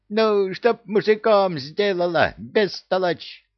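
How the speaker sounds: high, squawky scolding; exasperated parrot. Acted. Parrot explaining to the puzzled youth, high and exasperated. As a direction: scold a dimwit as an irate bird.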